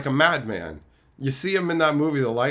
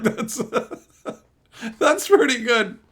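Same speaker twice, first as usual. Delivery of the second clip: laughing approval; 9 semitones higher, 4 dB louder than usual. Retold or imitated. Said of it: "And I was like".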